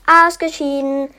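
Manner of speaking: lauter Ruf über das Spielfeld; rufend. Acted. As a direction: ruf es triumphierend laut. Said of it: "rief [name]". ([name] ruft laut aus)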